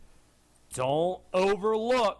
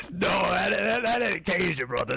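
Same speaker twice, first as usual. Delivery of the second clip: Cajun accent. Shown as a demonstration. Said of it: "This is Cajun right here".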